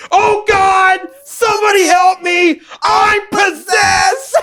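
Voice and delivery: high, hammed-up cry for help; panicked scream. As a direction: scream in mock panic, drawing out the last words. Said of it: "gets a panic inside of him and he stands up again and screams".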